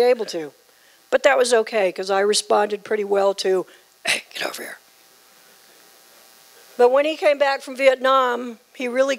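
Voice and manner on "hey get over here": soft voiceless whisper. Retold or imitated. Daughter imitating her father's whispered call. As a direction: breathe it with no voice. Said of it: "He talked in a whisper to me".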